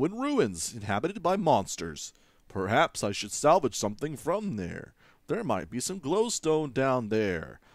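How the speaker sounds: put-on British RP accent. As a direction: read the quest text in RP English. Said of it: "Should that be my R P voice?".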